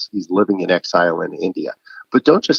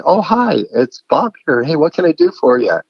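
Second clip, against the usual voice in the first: bright, lifted pitch like picking up a call; cheery phone greeting; 7 semitones higher, 3 dB louder than usual. Retold or imitated. Answering a friend's call, cheery and high.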